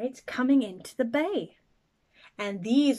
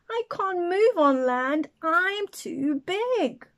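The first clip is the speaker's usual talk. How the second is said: a lightly voiced whale, higher and slow; 7 semitones higher, 5 dB louder, slower than usual. Whale telling the snail it cannot move, slow and helpless. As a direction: drag out the last words helplessly. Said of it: "said the whale".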